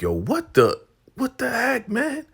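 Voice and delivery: very high, loud disbelief; shocked outburst. Retold or imitated. Brian stunned after Andrew knocks Lynn out, shocked shriek. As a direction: cry out in shock.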